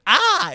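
high, loud frightened yelp; hammed-up yelp of fright; mock startled yelp. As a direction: squeal like a scared man bolting. Man fleeing from knife.

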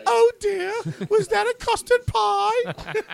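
very high, loud mock-woman voice; mimicked woman passer-by. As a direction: squeal it slowly as a hammed-up female bystander. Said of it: "a woman walking behind him going".

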